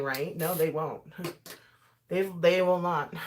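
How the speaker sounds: normal talking voice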